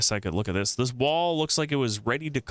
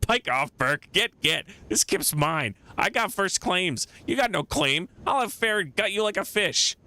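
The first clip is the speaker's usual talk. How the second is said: raised, threatening rant of a filthy beggar; angry scavenger; 9 semitones higher, slower than usual. Acted. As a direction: snap at him to get off your patch. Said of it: "His face turns bright red".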